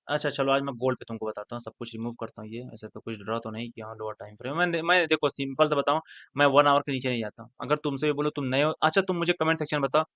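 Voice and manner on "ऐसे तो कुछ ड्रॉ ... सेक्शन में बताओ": ordinary talk, nothing marked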